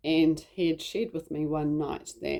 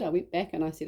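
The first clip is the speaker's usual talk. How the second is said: usual speaking voice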